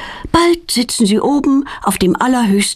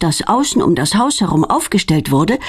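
ordinary delivery

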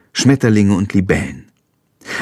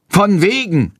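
strong voice; 8 semitones higher than usual. A narrator's reading. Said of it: "ertönt eine kräftige Stimme".

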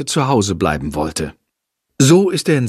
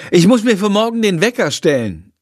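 voiced mother; 9 semitones higher than usual. Mother explains need for alarm.

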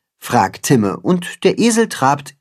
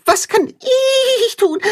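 very high, slow, whiny plea; high pleading calf voice; begging little calf; slower than usual. A narrator's reading. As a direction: beg eagerly like an excited calf. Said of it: "bettelt Tacho".

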